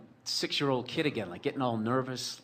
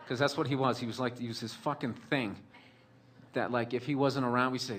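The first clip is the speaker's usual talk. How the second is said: ordinary delivery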